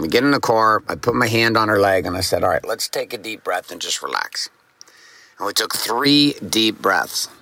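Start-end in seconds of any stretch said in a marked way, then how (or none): 2.4-4.5 calming. Retold or imitated. Dad calming panicking daughter.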